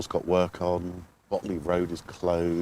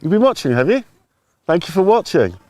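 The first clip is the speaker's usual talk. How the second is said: loud, much higher presenter voice; TV presenter sign-off; 11 semitones higher, 13 dB louder than usual. Pretend. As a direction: sign off like a TV host. Mock shopping-channel sign-off.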